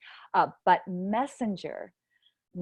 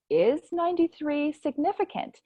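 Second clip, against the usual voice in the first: wondering question; 8 semitones higher than usual. Retold or imitated. Author recalls questioning her own title, wondering aloud. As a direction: ask it as a puzzled musing.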